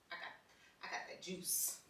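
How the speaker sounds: breathy whispered boast; hushed playful brag. Real, not acted. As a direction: whisper it like a secret flex. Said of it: "bragging aside".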